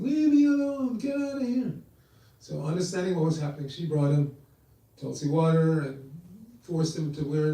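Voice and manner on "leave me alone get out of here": high, strained mock yell. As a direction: imitate a dying man's yell, pitched high. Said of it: "He was yelling".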